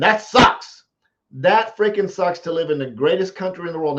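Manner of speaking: loud, high and indignant; shouting over an empty fridge; angry outburst. Real, not acted. Anger at childhood poverty.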